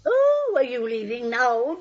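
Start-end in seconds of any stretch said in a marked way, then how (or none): 0.0-0.5 much higher and louder sudden oh; startled oh. Acted. Aunt startled that Jonathan is leaving, high sharp oh. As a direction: caught off guard, a squeak of surprise.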